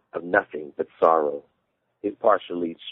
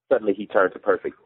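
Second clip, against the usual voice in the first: ordinary delivery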